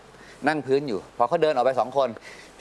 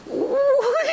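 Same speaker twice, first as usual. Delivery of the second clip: surprised squeak; much slower than usual. Retold or imitated. Imagined moment, exclaims.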